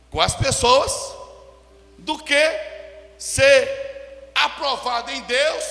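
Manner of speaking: loud, slow scripture declamation; forceful preaching. Real, not acted. Preacher quotes Corinthians to church, loud measured declamation. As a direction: drive each phrase out to the congregation.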